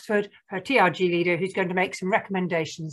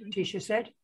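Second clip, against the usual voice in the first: plain reading voice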